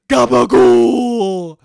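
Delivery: slow, loud, drawn-out Italian-American shout of Gabagool; aggressive Italian accent. Retold or imitated. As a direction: bellow Gabagool like a cartoon mobster. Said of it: "Do weird fucking aggressive accents".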